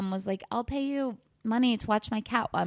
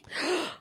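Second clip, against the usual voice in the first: sharp high mock gasp; dramatic gasp; 9 semitones higher than usual. Telling how her friend ghosted her, a mock scandalized gasp.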